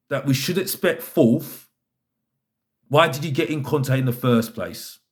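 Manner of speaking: normal talking voice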